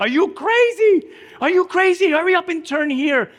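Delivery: high, loud, frantic yell of a terrified examiner; panicked scream. Retold or imitated. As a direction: scream it from the back seat in alarm. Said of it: "he screams from the back".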